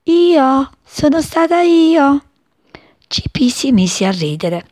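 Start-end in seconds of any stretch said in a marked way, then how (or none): 0.0-2.2 a timid little flower, high and slow; a small bashful flower voice; tiny voice. A narrator's reading. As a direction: answer shyly, barely daring. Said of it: "con un filo di voce rispose".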